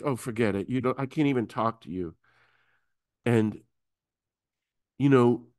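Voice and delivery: usual speaking voice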